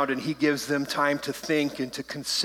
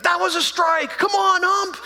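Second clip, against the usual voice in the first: much higher, louder mock shout from the stands; yelling at the ump; 5 dB louder than usual. Little League parent yelling at the umpire: much higher, louder mock shout. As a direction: imitate a baseball parent yelling. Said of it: "they're yelling".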